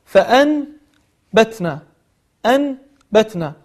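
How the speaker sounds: فأنبتنا broken after a clear n, then batna; word split at noon. Shown as a demonstration. Teacher showing noon before ba, فأنبتنا said in separate parts. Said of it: "نون ساكنة بعد حرف الباء".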